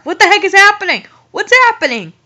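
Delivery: high, loud, fast confusion; baffled outburst. Real, not acted. Confused by the story's plot, a baffled outburst. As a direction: throw up your hands in disbelief. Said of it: "baffled at the story".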